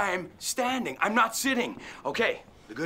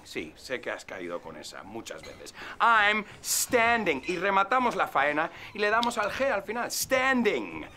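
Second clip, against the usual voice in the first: ordinary talk, nothing marked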